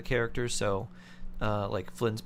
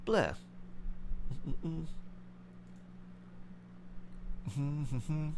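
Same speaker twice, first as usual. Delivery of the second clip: burnt mouth on tea; 4 semitones higher, 8 dB quieter, much slower than usual. Real, not acted. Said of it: "I just burnt my mouth on tea".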